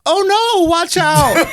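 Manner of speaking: high-pitched imitation of an alarmed girlfriend; girlfriend's warning. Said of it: "I just hear my girl go".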